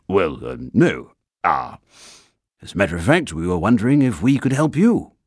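flustered, rushing to the end; embarrassed. A narrator's reading. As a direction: stumble then hurry it out. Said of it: "looked rather embarrassed".

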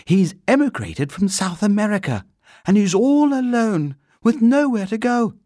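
put-on high-pitched, loud child's pleading; earnest girl. A narrator's reading. Girl explaining the bear is all alone, earnest. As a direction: plead his case in a high girlish voice.

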